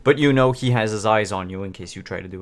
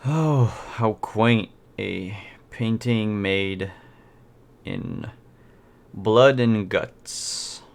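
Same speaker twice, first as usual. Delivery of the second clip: slow, drawn-out condescension; condescending buyer; much slower than usual. Acted. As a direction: savour each word slowly, mildly disdainful. GM voices buyer's disdainful appraisal.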